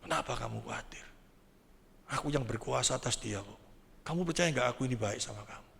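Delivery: soft, low voice of God consoling; gentle reassurance. Acted. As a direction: speak quietly and tenderly, as in a private dialogue. God's gentle dialogue acted out. (suara lembut rendah menenangkan)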